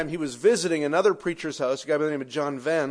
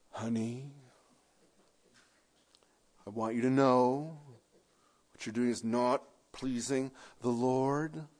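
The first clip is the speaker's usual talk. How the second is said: much softer, lower, sugary scolding; a hint of pious sweet reproach; mock gentle rebuke; 5 semitones lower, 9 dB quieter than usual. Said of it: "So you're going".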